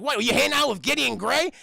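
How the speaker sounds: loud, high mock-indignant question; Grunkle Stan. Stan questioning his niece, loud and incredulous. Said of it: "He's like".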